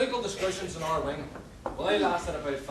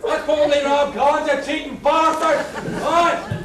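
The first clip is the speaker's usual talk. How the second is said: loud, higher accusing shout; angry heckle; 9 semitones higher, 13 dB louder, slower than usual. Acted. Punter shouting that the masked jockey is a cheat. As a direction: call the cheat out to the room.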